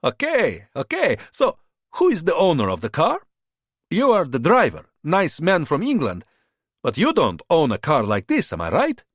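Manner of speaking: charming border fixer. A narrator's reading. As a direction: sell yourself like a friendly tout. Bogdan's held voice.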